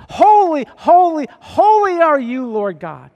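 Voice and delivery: high, loud cry of holy; proclaimed aloud. Pretend. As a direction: cry out holy to each other. Imagining whole church proclaiming.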